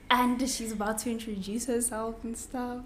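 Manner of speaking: ordinary delivery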